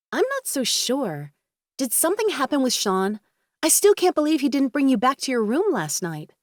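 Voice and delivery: concerned friend voice. A narrator's reading. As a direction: voice the fretting friend, lifted. Kendra voiced by narrator.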